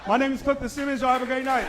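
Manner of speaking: high, loud cheerful sign-off; phone rep sign-off. Acted. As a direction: close out the call brightly.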